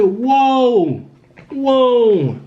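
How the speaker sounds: high, drawn-out astonished exclamation; acting out stunned alarm, high and falling; retold shock. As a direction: recoil in amazement, pitch jumping up. Retold shock at brother's behaviour.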